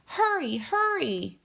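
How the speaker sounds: high urgent call; calling urgently. A narrator's reading. Grandmother calling her grandson home urgently. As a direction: hurry him home with an urgent cry. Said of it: "she called".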